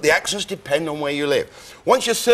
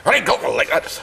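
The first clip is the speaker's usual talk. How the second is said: guttural Lowland Scots, raised pitch; guttural Scottish accent; 3 semitones higher than usual. Shown as a demonstration. Demonstrating accents of the Scottish lowlands, guttural Scots. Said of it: "The very thing's very guttural".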